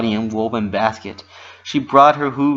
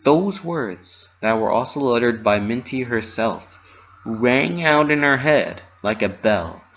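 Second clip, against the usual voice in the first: plain reading voice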